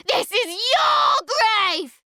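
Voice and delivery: very high, loud, slow scream with crying; girl screaming at her uncle while pushing him. Acted. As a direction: scream it with all her strength. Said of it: "Molly screams".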